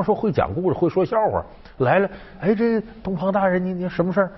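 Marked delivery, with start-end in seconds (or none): 1.8-4.3 a lightly voiced little groom, pitched higher; eager higher-pitched greeting to a superior; 小矮人招呼大人. (小矮人略提高嗓门招呼大人)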